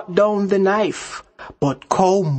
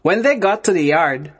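usual speaking voice

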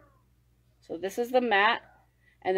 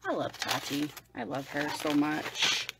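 soft gushing; 6 semitones lower, 6 dB quieter than usual. Real, not acted. Gushing to viewers about Tati, soft adoration. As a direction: melt a little as you say it. Gushing over the brand owner.

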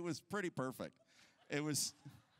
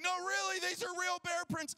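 urgent insisting; 11 semitones higher than usual. Retold or imitated. Dad insisting to his doubting wife, panicked and urgent. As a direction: insist in a panic it's real. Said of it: "I'm like".

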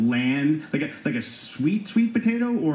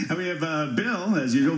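laughing; 6 semitones higher than usual. Real, not acted. Laughs into the intro.

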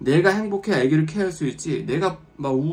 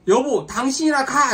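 higher, louder, slow snippy retort; irritated wife brush-off; 9 semitones higher, 6 dB louder, slower than usual. Retold or imitated. Grumpy wife snapping at husband who suggests the sea, higher and louder. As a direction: brush him off, go by yourself then.

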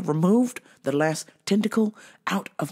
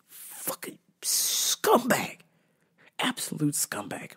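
whisper; 6 dB quieter, slower than usual. Real, not acted. Disgusted aside about [name].

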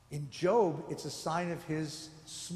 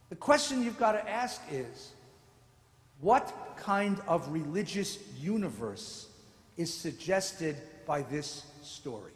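ordinary delivery

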